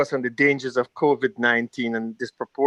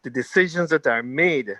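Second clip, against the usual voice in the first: ordinary talk, nothing marked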